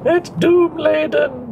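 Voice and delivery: high, slow, theatrical doom; doom-laden voice. Pretend. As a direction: intone it like a prophet of doom. Mock-dramatic comment on explosions.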